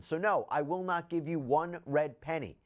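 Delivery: usual speaking voice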